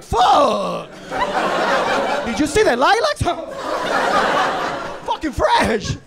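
high, loud, over-the-top raving; hammed-up shouting, blown away with excitement; mock ecstatic. Party guest raving about the flowers, mock ecstatic shouting.

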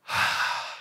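long happy sigh onto the bed; contented mock sigh. Preacher acts flopping on the bed, contented sigh. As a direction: sigh like you're finally home. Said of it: "and you're like".

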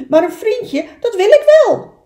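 very high, loud and keen; eager duck voice. Acted. Duck accepting an offer of friendship, high excited voice. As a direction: burst out high and loud with delight.

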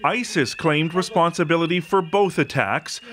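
ordinary talk, nothing marked

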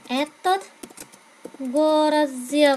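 этот городе said slowly, syllable by syllable; slow teacher demo of этот городе; Russian model phrase. Shown as a demonstration. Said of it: "Từ город phải đổi sang cách sáu".

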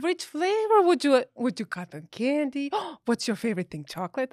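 high, loud, eager voice with a gasp; cooing dentist staff. Retold or imitated. Staff offering fluoride flavors to her daughter, high and eager. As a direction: excitedly offer flavors to a kid. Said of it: "And then they're like".

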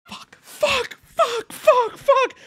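frustrated high yell. Real, not acted. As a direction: blow up at the game. Furious at the game.

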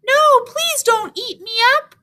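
high, small pleading voice; littlest goat pleading. A narrator's reading. Littlest goat begs the troll not to eat him, high plea. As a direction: beg like a tiny goat. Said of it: "littlest billy goat Gruff said".

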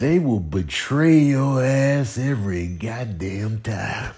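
usual speaking voice

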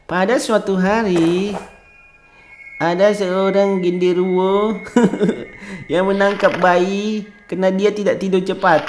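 slow once-upon-a-time story read; slow story narration with a giggle; bedtime storytelling. Acted. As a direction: read it slowly like a bedtime tale. Reading bedtime story to baby.

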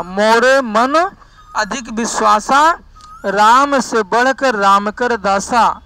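slow, drawn-out scripture quotation; verse recitation. Acted. Teacher quotes Tulsidas's verse to students, slow recitation. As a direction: recite the verse slowly, stretching the words.

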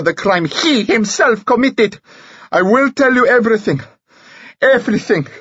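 high, loud, desperate pleading; frantic confession. Acted. Handcuffed prisoner confesses to police, panicked high cry. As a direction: blurt it all out in terror of the gallows.

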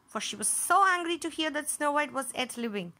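ordinary delivery

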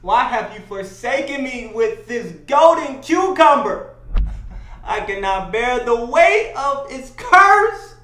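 loud, high, slow theatrical lament; anguished plea to God. Acted. Man bemoaning his curse to God, hammed-up anguished plea. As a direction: cry out to heaven, drawn out and overwrought. Mock prayer over his irresistible gift.

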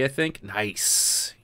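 ordinary talk

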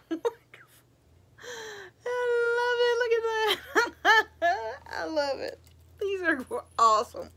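so happy it spills into laughter; laughing through tears. Real, not acted. Vlogger thrilled by a friend's gifts, laughing through happy tears.

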